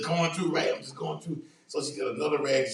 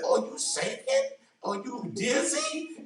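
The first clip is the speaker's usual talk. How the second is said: much higher, slow, fussing wife voice; mock-worried wife; 10 semitones higher, much slower than usual. Wife asking the shaking husband if he's dizzy, high mock concern. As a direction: play the wife hovering over him, drawn out. Imitating wife's worry.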